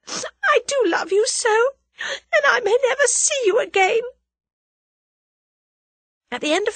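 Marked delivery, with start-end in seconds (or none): 0.1-4.0 weep the words out; crying. A narrator's reading. Tuppence sobbing over Tommy's snapshot, crying. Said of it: "she cried".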